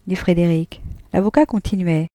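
plain reading voice

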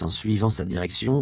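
ordinary delivery

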